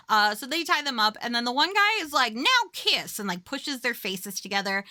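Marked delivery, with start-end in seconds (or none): 2.3-3.2 high-pitched mock command; teasing order. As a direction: imitate a creep ordering a kiss, pitched up. Masked attacker's order, retold.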